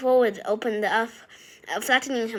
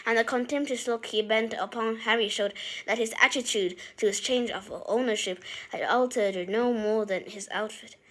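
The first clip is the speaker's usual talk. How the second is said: ordinary delivery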